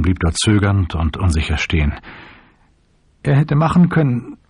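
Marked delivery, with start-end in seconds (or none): 3.2-4.4 high, tearful protest; acted weeping, pitch raised; through tears. A narrator's reading. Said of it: "sagte er unter Tränen".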